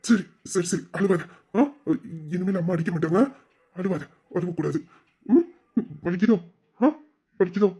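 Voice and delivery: soft, low comforting murmur; soothing a child. Acted. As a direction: hush a crying little girl gently. Uncle consoling crying child.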